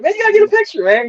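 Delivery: high, far-off put-on dad voice; excited dad. The dad urges his son to get a picture, in a high excited voice. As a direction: squeak it out like an eager dad.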